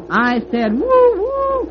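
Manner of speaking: very high whistled woo; loud high train hoot; whistle cry. Acted. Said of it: "cried Herman".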